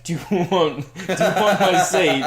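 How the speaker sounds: imitating the kid. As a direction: mimic the awkward schoolboy. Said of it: "and was like".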